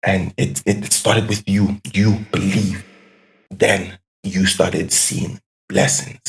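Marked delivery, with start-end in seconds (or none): none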